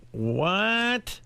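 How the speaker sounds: high, drawn-out questioning call; deaf mother. Retold or imitated. Mother across the room missing the talk, hard of hearing. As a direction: imitate a mother who can't hear. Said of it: "You're deaf as a doorknob".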